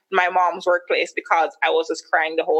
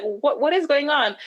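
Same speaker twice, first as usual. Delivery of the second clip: confused; 7 semitones higher than usual. Retold or imitated. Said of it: "super, like, confused".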